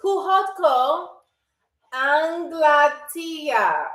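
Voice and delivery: Puhotko Anglatia split and dragged out, Anglatia very slow; slow it right down for learners; slow Finnish demo. Shown as a demonstration. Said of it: "listen to it slowly".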